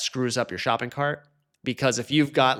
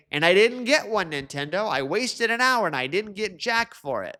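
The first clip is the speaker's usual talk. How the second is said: raised-pitch indignant griping; imitated aggrieved whine; mock complaint; 6 semitones higher than usual. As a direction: gripe at Nintendo like a wronged customer. Imitating angry complaining YouTubers.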